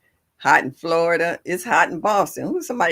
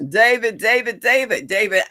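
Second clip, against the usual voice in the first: calling out; 5 semitones higher, 5 dB louder than usual. Real, not acted. Calling out viewer in chat.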